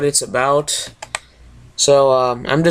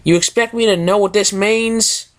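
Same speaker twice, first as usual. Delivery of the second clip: Applejack voice; 6 semitones higher than usual. Acted.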